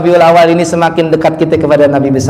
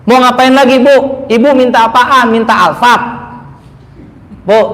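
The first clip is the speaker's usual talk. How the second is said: ordinary talk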